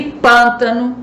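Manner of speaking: "pântano" devagar, com PÂN fechado e forte; sílaba tônica fechada. Shown as a demonstration. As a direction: alongue o PÂN inicial de "pântano". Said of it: "onde o som fica fechado". ("pântano" pausado, marcando a primeira sílaba fechada)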